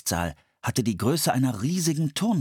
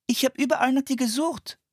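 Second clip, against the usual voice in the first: put-on small boy calling. A narrator's reading. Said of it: "rief er".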